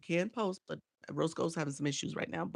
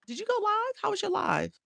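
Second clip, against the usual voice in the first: excited; 4 dB louder than usual. Real, not acted. Eager question to a viewer.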